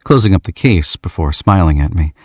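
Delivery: ordinary delivery, nothing marked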